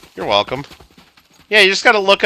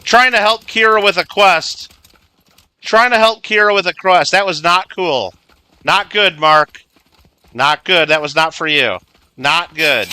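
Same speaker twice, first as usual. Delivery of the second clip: playful telling-off, higher at first, easing down; mock mad scolding; 5 semitones higher, 3 dB louder than usual. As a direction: scold him like a naughty pet, half-serious. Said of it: "This is my mad voice".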